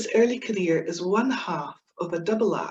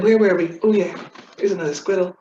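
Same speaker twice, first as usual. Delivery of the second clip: normal talking voice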